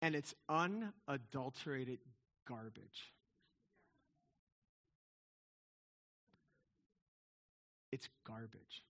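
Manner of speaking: low, hushed, slow verdict; deadpan dismissal. Real, not acted. Preacher dismisses movie romance as garbage, quietly deadpan. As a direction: drop to a quiet, dead-serious put-down.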